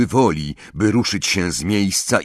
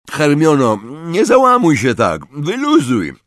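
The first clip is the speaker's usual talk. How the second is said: louder, higher mouth-full mumble; 6 semitones higher, 5 dB louder than usual. A narrator's reading. Ron tells Hermione to relax, mouth full. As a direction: talk thickly round a mouthful. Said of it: "wymamrotał z pełnymi ustami".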